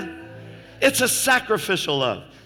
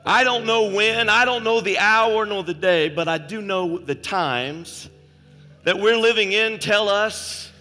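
loud, high preaching, pressing the congregation to step forward; preaching at full force; 7 semitones higher, 8 dB louder than usual. Acted. Preacher to congregation, about the coming throne, loud fervent preaching. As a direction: raise the church to its feet with a shout.

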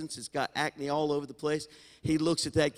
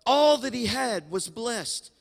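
preached emphasis; 9 semitones higher, 6 dB louder, slower than usual. Shown as a demonstration. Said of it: "repeats key phrase emphatically".

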